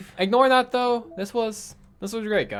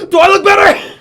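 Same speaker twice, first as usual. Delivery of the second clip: squeaky character line; 13 semitones higher, 14 dB louder than usual. Acted. Reading a character's line aloud.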